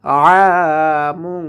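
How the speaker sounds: "আমুন" slowly chanted, long first vowel; drawn-out recitation. Shown as a demonstration. Teacher showing the one-alif stretch, long-held "আমুন". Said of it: "এক আলিফ টেনে পড়তে হবে".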